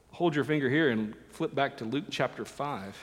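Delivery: ordinary talk, nothing marked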